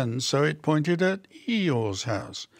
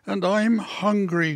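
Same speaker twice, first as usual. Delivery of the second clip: higher, mildly whiny complaint; groaning; 6 semitones higher than usual. A narrator's reading. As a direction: moan it lightly, pitched up. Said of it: "groaned P-, Pooh".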